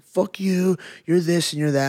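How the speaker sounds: retold shouting, high and loud; imitated angry screaming. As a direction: imitate an irate stranger yelling. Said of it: "he comes up, and he's screaming".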